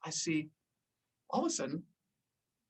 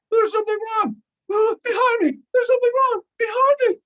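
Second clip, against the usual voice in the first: much higher, louder frantic voice; mock panic of a friend in trouble; panicked friend; 7 dB louder than usual. Friend panics, tank pulling him.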